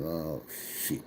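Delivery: very low, almost swallowed curse when the test comes back; deflated oh shit. Retold or imitated. Busted by the drug test, a low swallowed curse. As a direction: let it drop out under your breath, caught.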